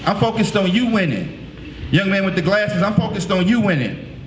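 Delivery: normal talking voice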